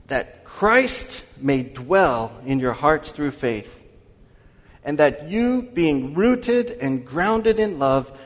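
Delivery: slow, loud solemn reading of the verse; measured pulpit reading voice; scripture reading. Acted. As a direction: proclaim the scripture slowly and fully. Preacher reads scripture aloud.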